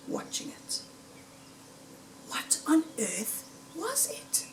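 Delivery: a hushed, tense aside; hushed wonder. A narrator's reading. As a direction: breathe it, wondering what's out there. Tense moment, fox watching.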